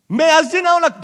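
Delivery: very high, loud outburst; a mock parent yelling before the belt comes out; furious parent. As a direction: explode like a parent whose patience ran out. Parent about to use the belt.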